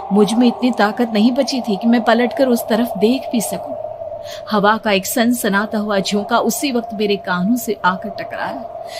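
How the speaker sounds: ordinary delivery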